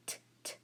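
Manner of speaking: soft unvoiced "T" bursts; whispered, separated "T" sounds; isolated letter sound. Shown as a demonstration. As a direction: click out "T" twice without a vowel. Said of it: "I have".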